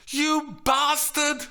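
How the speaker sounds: high, loud, slow outraged yell; loud high-pitched angry shout; furious shout. A narrator's reading. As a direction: hurl it out in fury. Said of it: "she shouted".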